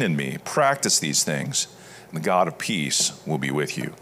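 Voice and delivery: measured, solemn reading of scripture, phrases falling low. Acted. Pastor reading Philippians to the congregation, solemn and measured. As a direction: read it like a sermon passage, deliberate and reverent.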